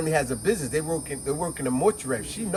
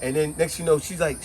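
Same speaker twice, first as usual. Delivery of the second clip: ordinary talk, nothing marked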